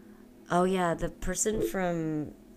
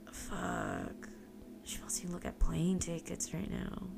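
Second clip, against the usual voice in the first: quiet groan; 9 dB quieter than usual. Retold or imitated. Tired inner thought.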